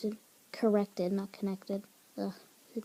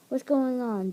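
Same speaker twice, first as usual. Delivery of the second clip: louder, higher demand; 4 semitones higher, 6 dB louder than usual. A narrator's reading. Boss walks in on the argument, demanding. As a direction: burst in wanting an explanation. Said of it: "Daisy demanded".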